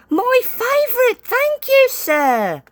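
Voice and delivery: bursting with glee; eager. A narrator's reading. Percy thanks the boss, very eager. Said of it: "said Percy eagerly".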